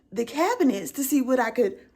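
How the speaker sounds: ordinary talk, nothing marked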